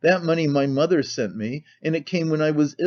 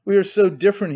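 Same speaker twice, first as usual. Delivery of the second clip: higher, exclaimed lament; crying out; 6 semitones higher, 4 dB louder, faster than usual. A narrator's reading. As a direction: cry out with sudden feeling. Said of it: "he cried again".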